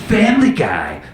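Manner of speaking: mock network promo, each name separated; TV promo announcer. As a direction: punch out titles and growl the network name like a curse. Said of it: "every commercial is just like".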